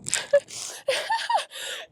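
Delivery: high breathy burst of laughter; startled laugh. Real, not acted. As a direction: laugh out in surprise at the jump. Rider laughs after surprise jump.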